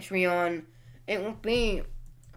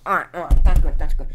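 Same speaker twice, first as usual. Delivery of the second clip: loud settling; 3 semitones lower, 14 dB louder, faster than usual. Real, not acted. Reacting to being bumped.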